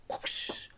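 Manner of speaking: voiceless whip swish noise; a quick imitated whip sound; whip whoosh. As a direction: mimic a whip lashing through the air.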